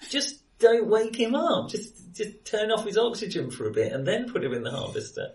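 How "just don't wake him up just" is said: ordinary talk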